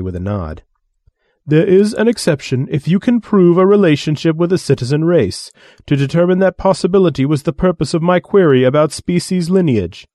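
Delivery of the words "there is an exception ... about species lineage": lofty, condescending alien voice; haughty alien envoy. Acted. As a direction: sneer down at lesser beings. Alien envoy explains exception.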